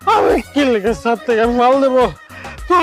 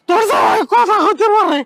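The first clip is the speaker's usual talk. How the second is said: shouting; 9 semitones higher than usual. Acted. Friend exposes the mute husband.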